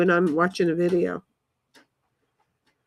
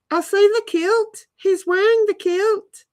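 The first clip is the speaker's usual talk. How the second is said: put-on Scottish accent; 11 semitones higher than usual. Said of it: "Bobby knows I can't do accents".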